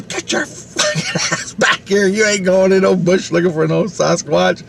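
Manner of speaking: high, strained mock yell; scolding mom. Mom calling her kid back from the bush, scolding. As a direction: imitate a mother ordering her kid back.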